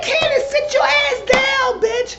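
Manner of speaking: very high, loud telling-off; exasperated scolding. Retold or imitated. Retells telling Candace to sit down.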